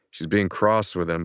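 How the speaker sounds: usual speaking voice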